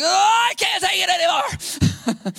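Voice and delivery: high, drawn-out mock demon wail; hammed-up cry of unbearable torment. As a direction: shout it slowly like a demon in torture. Mimics tormented demon in church.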